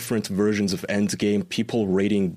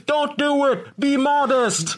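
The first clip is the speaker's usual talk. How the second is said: much higher pleading cry; mock prudish heckler; slower than usual. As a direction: shout at the screen to stop her. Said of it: "the one dude is like".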